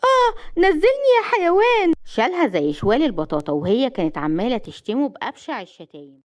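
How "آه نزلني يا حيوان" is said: high, loud yell of protest; outraged cry. Acted. Woman being hauled off like a sack, shrieking in outrage. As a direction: shriek it out in indignation. Carried off against her will.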